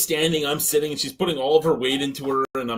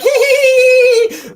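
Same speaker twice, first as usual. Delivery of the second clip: high, loud imitation of her giggle; mock giggling; 14 dB louder, slower than usual. Imitating coworker laughing her ass off, mock giggling. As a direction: squeal out her hee-hee laughter. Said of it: "she's laughing her ass off".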